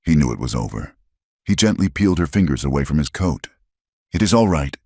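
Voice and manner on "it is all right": usual speaking voice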